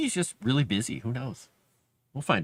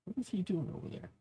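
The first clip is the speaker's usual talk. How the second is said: muttered to self; 5 semitones higher, 12 dB quieter than usual. Real, not acted. Spotting the cat on ladder.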